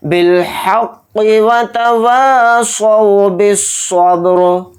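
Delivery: बिल हक़ि chanted through without a pause; continuous slow tajweed chant; joined recitation. Shown as a demonstration. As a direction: link हक़ into व तवासौ in one breath. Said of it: "क़ाफ के नीचे जेल लगाकर पढ़ेंगे".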